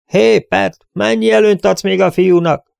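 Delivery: high, loud holler; shrieked call. A narrator's reading. Rider shouting to the sheriff into the night, shrieked call. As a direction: yell it out across a distance. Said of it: "rikoltotta egy hang az éjszakába".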